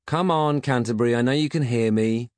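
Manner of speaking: high, loud coaxing; insistent. A narrator's reading. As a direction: insist to someone ignoring you. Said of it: "insisted Peg".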